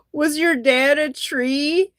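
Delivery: slow, drawn-out question with a rise; deadpan comeback. Retold or imitated. Retelling the friend's comeback to the comedian, slow and deadpan. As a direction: lay the joke down slowly and deliberately. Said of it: "then my friend Jack Scotty goes".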